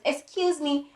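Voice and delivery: high, small pleading call; tiny voice. Retold or imitated. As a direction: imitate your own tiny voice calling for attention. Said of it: "Me and my tiny voice".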